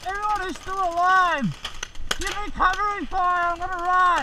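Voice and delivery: loud call for covering fire; cover me shout. Real, not acted. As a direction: shout to the team over gunfire.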